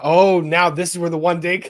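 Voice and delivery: normal talking voice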